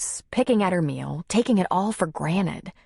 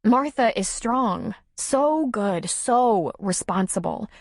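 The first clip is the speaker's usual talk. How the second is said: higher, drawn-out woman's voice; admiring Nora; 6 semitones higher, slower than usual. Acted. Nora telling Tom about Martha, admiring. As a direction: praise her with slow, high emphasis. Character dialogue.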